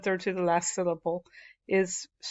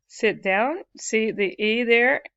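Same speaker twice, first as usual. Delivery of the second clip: ordinary talk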